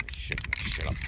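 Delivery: a quick conspiratorial whisper. Pretend. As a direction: whisper "Shaking the shit up" low, with no voice. Repeated line, first one whispered.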